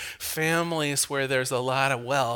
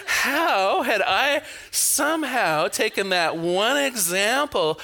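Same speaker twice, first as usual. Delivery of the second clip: laughing; 5 semitones higher, slower than usual. Real, not acted. Laughing at own mistake.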